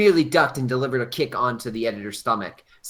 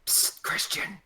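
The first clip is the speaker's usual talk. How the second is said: whisper; 7 dB quieter, slower than usual. Acted.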